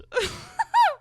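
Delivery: shrieking laugh; loud high laugh. Real, not acted. Reader shrieking with laughter at the misread. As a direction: lose it in a high cackle.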